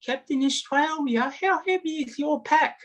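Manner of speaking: put-on high, slow little-kid question; little kid asking. A little pupil asks the costumed walker about his pack in a high, slow voice. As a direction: pipe up sweetly like a tiny pupil. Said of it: "he's like".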